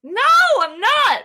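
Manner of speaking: high, loud and slow protest; loud flustered denial. Real, not acted. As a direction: protest it loudly and drawn out.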